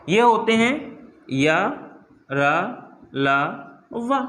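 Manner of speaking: each letter said apart with pauses: या, रा, ला, वा; slow spelled-out letters. Shown as a demonstration. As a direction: say या, रा, ला, वा one by one, slowly. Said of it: "ठीक है, चार होते हैं". (अक्षर अलग-अलग धीरे बोलें)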